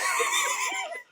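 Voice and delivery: high-pitched burst of laughter. Real, not acted. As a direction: crack up laughing. Laughing at friend's complaint.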